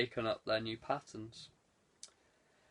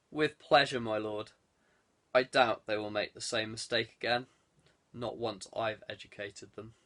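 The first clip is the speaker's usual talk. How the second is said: low, relishing henchman voice; menacing reply; 6 semitones lower than usual. A narrator's reading. Chamberlain promising punishment to his lord, menacing and low. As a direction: savour the threat, dropping low. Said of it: "A menacing smile appeared".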